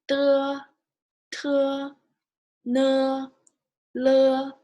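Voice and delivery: teacher leading a pinyin drill; Mandarin initials drill. Shown as a demonstration. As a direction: call out Bo, po, mo, fo crisply for repetition. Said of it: "we'll say it one more time from here".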